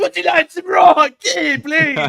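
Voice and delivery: high, loud mock squeak; shouted squeaky impression; imitating the squeaky voice. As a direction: parody his friend's high-pitched offer. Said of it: "It's like".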